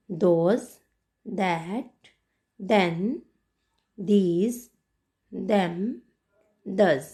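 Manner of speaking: "Those" to "does", each opening on द, word by word; rising tune on each word from "Those"; repeat-after-me pronunciation. Shown as a demonstration. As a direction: model "Those" through "does" with pauses for pupils. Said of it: "एक बार फिर से हम इसे pronounce करते हैं".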